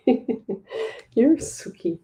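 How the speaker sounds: drawn out and giggly, doting; laughing coo. Real, not acted. Owner cooing and laughing at her nuzzling cat.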